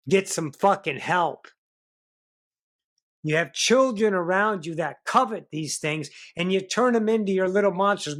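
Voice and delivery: loud, high, shouted tirade; angry rant. Real, not acted. Speaker berating drunk parents listening out there, shouting angrily. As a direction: yell at them to wake up and stop.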